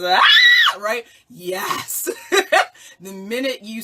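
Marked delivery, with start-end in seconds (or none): 0.0-0.8 phoenix scream. Retold or imitated. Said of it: "screaming, beautiful orange yellow bird".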